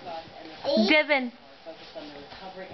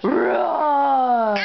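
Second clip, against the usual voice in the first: put-on a light, louder roar for the toddler; playful lion roar; 3 dB louder than usual. Shown as a demonstration. Mother to toddler, showing what the lion says, playful roar. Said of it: "What's the lion do?".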